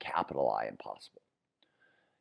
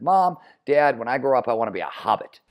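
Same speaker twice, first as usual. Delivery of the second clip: put-on excited child voice; 6 semitones higher, 6 dB louder than usual. Retelling his childhood wish.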